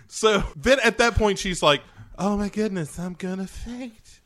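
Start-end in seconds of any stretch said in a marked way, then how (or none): 2.2-4.2 high-pitched fainting damsel; hammed-up swoon, pitched way up; mock swooning. Heroine about to pass out, high mock swoon.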